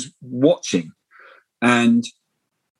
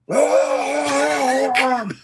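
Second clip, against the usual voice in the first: high, far-off cry of a crowd imitated; imitating a roar heard from across the airport; distant crowd roar; 9 semitones higher than usual. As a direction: mimic a faraway cheer going up by the gate. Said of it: "like that, from somewhere".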